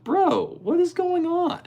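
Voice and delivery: very high, squeaky exasperation; disbelief. Real, not acted. Streamer to chat about the strange gift, squeaky disbelief. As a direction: throw up your hands in squeaky disbelief. Real disbelief retelling the story.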